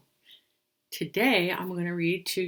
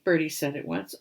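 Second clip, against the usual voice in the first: ordinary delivery, nothing marked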